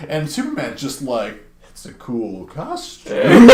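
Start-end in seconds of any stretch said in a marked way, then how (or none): 1.6-3.5 dopey laughing compliment. Retold or imitated. Drugged Clark admires assassin's costume.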